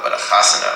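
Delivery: "chasana" said loud with a rising, happy lift; festive Yiddish chasana. Shown as a demonstration. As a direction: make "chasana" sound like fun. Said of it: "oh, that's fun".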